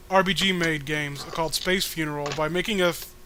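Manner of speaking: ordinary delivery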